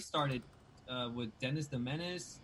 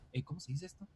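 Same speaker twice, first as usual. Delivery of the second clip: quiet aside to wife; 2 semitones higher, 6 dB quieter, much faster than usual. Retold or imitated. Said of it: "look over at my wife and be like".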